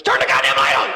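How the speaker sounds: loud panicked yell; a scared kid shouting in the dark; child in me. Acted. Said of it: "But the child in me goes".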